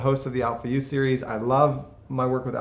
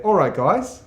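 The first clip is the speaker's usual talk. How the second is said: All right, guys with guys as goyz, higher and louder; Australian accent; 4 semitones higher, 5 dB louder, slower than usual. Shown as a demonstration. Visitor showing off his Australian All right, guys, bright Aussie accent. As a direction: push the vowels into a broad Aussie goyz. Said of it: "But I say".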